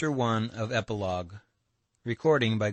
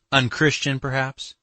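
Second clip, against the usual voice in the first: higher, challenging question; defiant; 6 semitones higher, 6 dB louder than usual. A narrator's reading. Katya challenging Alyosha about the escape, defiant. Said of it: "even more defiantly".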